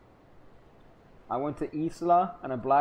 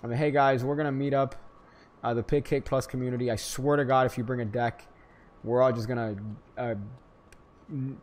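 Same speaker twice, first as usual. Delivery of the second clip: usual speaking voice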